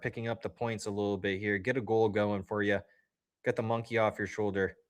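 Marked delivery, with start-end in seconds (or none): none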